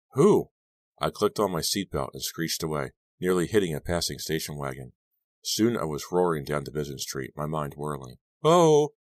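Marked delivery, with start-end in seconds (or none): 0.1-0.5 frightened. A narrator's reading. Panicked question to herself. 8.4-8.9 sudden high, loud gasp of fright; startled cry. A narrator's reading. Driver startled by a face in the mirror, crying out. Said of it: "I cried out".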